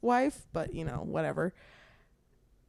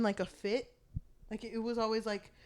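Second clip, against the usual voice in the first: usual speaking voice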